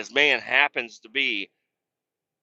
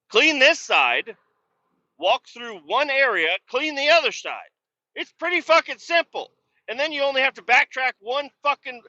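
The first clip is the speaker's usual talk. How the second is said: high-pitched, emphatic scolding; exasperated instructing; 12 semitones higher, 3 dB louder than usual. Retold or imitated. Telling the tangled guy how to clean, exasperated. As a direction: spell out simple steps to someone hopeless, voice raised. Acted lecture to worker on cleaning.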